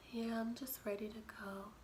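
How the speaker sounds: hushed, holding back tears; whisper. Retold or imitated. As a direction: whisper so mom won't notice. Hiding tears from mom.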